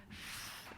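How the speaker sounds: "f" as long breath friction, no voice; unvoiced friction sound. Shown as a demonstration. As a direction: blow out "f" without any vocal buzz. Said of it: "the unvoiced f".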